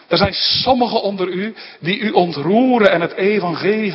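high and loud, declaiming the apostle's rebuke; forceful preaching. Real, not acted. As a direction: thunder it at the wayward Galatians. (krachtig preken, hoog en luid vermanend)

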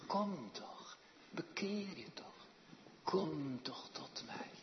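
God's hushed coaxing call; gentle divine invitation. Acted. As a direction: coax the sinner home tenderly. Voicing God beckoning.

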